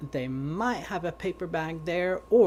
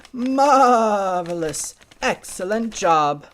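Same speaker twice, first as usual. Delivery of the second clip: high, drawn-out, loud praise; delighted goat; 7 semitones higher, 12 dB louder, much slower than usual. Acted. Goat praises his pupil pig, delighted. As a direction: exclaim grandly as a pleased goat. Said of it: "Mister Goat".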